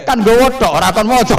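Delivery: very high, very loud snapping reply; shouted retort. Acted. Umar shouting his answer at Munkar Nakir, very high loud retort. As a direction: yell it back as a triumphant comeback.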